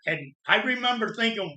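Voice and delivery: ordinary delivery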